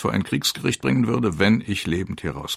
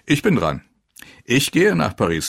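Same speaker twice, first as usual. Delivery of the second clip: raised, higher, lively call; eager volunteering; 7 semitones higher, 5 dB louder than usual. A narrator's reading. Sergeant jokingly claims the trip to Paris, eager and loud. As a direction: jump in as if claiming a prize.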